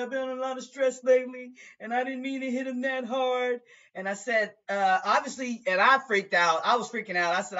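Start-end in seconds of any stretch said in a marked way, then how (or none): 0.0-3.6 higher, fast excuse; lightly voiced pleading mother. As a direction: rush out the apology. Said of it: "She said".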